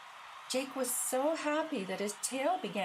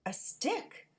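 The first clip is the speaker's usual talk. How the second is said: excited; 8 semitones higher than usual. A narrator's reading. Dog finds a stick.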